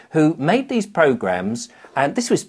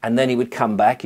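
ordinary talk

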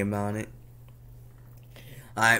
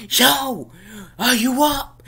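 high, loud wake-up shout; calling out; 14 semitones higher, 9 dB louder, slower than usual. Retold or imitated. Parent at a child's cracked door, calling out loudly. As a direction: holler it as if into a bedroom.

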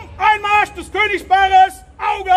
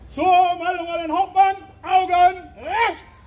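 barked drill commands; 11 semitones higher, 4 dB quieter than usual. Real, not acted.

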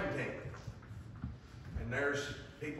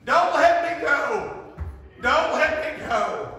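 very high, loud, slow plea; drawn-out pleading yell of the boy; desperate scream; 10 semitones higher, 10 dB louder, slower than usual. Retold or imitated. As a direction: scream and cling on. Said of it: "he started screaming".

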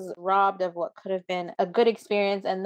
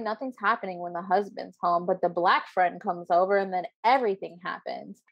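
ordinary delivery

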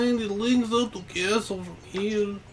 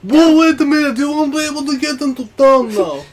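alarmed character; 4 semitones higher, 9 dB louder than usual. Acted.

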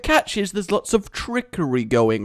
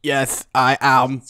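robot voice; 3 semitones lower than usual. Pretend. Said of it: "So bad".

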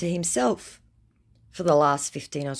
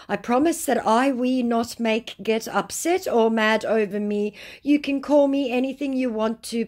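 high, imploring imitation of a begging scammer; mock pleading; 8 semitones higher, 3 dB louder than usual. Scammer begging a stranger to be friends, mock pleading. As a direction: plead in an exaggerated, higher voice.

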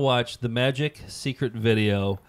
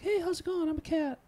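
very high, softer kitty voice; voice for cats; 6 dB quieter than usual. Acted. Holding up my cats, high-pitched cat voice. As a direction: speak for the cat in a tiny falsetto. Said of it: "It's the voice I do for my cats".

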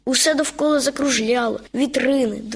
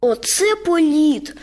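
delighted; 4 semitones higher, slower than usual. A narrator's reading. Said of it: "захоплено сказав зайчик".

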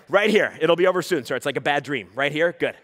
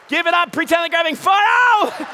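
high loud cheering to the crowd; rally them with a shout; excited emcee yell; shouted hype; 8 semitones higher, 4 dB louder than usual. Real, not acted.

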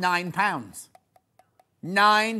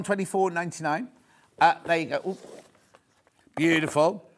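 usual speaking voice

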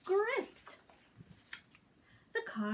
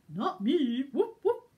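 dog voice; 5 dB louder than usual. Acted.

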